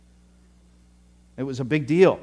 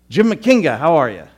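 mock formal greeting; 10 dB louder than usual. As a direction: hail him by full name. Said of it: "Nobody comes up to me and says".

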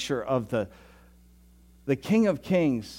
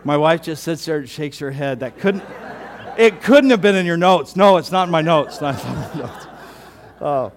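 loud laughing banter, higher pitched; 5 semitones higher, 10 dB louder than usual. Real, not acted. Pastor joking that wife shakes her head, loud laughter. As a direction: laugh through the teasing back-and-forth. Joking about wife's reaction.

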